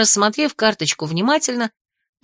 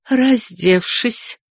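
slow, pointed; much slower than usual. Acted. Aunt adds pointedly.

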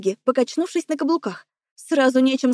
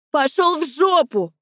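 slow, higher-pitched angry outburst; raised voice; 4 semitones higher, slower than usual. A narrator's reading. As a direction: draw out the insult, pitch raised. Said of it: "Повышая голос".

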